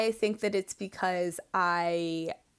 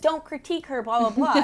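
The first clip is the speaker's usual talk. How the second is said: mock protest, pitched up, quick; indignant commenter; 6 semitones higher, 4 dB louder than usual. Podcaster quoting online commenters defending a dancer, mock indignant. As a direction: imitate a defensive fan, louder on the blahs.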